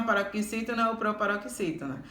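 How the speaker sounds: ordinary talk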